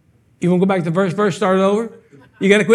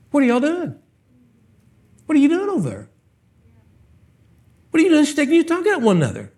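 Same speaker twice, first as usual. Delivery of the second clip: higher, building preacher's rebuke; rising rebuke; 4 semitones higher than usual. Acted. Preacher scolding his church members, higher and building. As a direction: scold them, pitch climbing.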